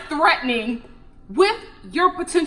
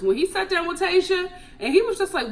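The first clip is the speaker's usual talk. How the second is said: usual speaking voice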